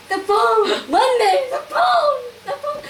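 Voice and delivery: very high mock sobbing, breaking into laughter; too tearful to talk. Retelling her sobbed news about the phone, high wail. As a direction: blubber it in imitated happy tears. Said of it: "And I couldn't talk".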